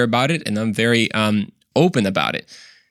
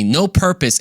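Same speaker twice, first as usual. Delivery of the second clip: ordinary talk, nothing marked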